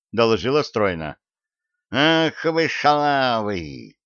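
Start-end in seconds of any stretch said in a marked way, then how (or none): 1.9-3.9 put-on slow, drawn-out old man's curse; high, slow, hateful exclamation; hateful cry. A narrator's reading. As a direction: draw the insult out with hatred. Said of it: "с ненавистью крикнул дед".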